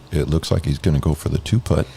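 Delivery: hushed golf commentator; soft, hushed play-by-play. Retold or imitated. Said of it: "I'd be like".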